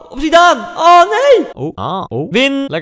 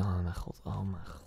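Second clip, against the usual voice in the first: whispered, much softer; despairing whisper; 12 semitones lower, 19 dB quieter than usual. Real, not acted. Gamer whispering to himself while losing. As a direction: breathe it out in defeat.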